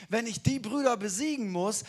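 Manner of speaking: ordinary talk, nothing marked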